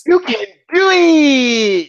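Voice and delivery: slow, high, drawn-out cheer; movie catchphrase. Pretend. Quoting the Waterboy catchphrase, slow and high. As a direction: stretch it like the Waterboy coach. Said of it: "Waterboy movie catchphrase".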